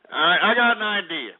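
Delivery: ordinary talk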